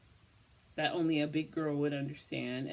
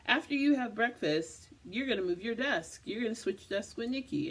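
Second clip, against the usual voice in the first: bossy boss voice; 6 semitones higher, 3 dB louder than usual. Retold or imitated. Boss quoted giving an order.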